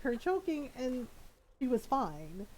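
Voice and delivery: ordinary talk, nothing marked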